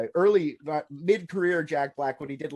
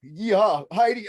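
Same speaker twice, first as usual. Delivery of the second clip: put-on Russian accent; 4 semitones higher, 6 dB louder, slower than usual. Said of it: "an accent. I can't do it".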